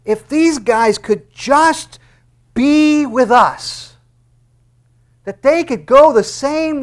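loud, slow, high pleading prayer; Jesus praying aloud to God for his disciples; fervent prayer. Acted. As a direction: stretch every word as you plead upward. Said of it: "he's turning to the Lord and he's saying".

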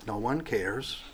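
soft, sad, hushed; hushed lament. Acted. Preacher voicing David's despair, hushed and sad. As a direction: let it fall almost to nothing. Repeats the verse's ending.

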